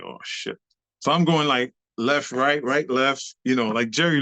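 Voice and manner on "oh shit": low breathy whisper of dread. Retold or imitated. Panicked dancer whispering to himself as PTSD hits. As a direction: mutter it under your breath in dread.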